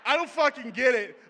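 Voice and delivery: loud, high frustrated outburst; exasperated shout. Real, not acted. Comic venting about his beard with a loud outburst. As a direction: blurt it out at full volume.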